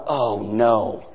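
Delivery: low, soft groan of dismay; inner dread. Retold or imitated. The pastor hearing about Ginger, low dismayed groan. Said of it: "And I'm going".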